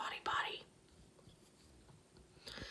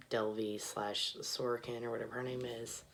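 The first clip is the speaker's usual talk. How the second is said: usual speaking voice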